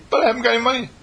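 mock complaint. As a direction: play the doubter who sees no money.

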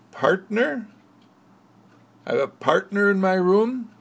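put-on a girl who never heard of a partner; lightly voiced astonished child; puzzled girl. Audrey is puzzled.

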